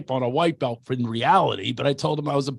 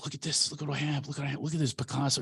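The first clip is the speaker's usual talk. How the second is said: hushed bragging rich guy; 9 dB quieter, faster than usual. Retold or imitated. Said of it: "He's going".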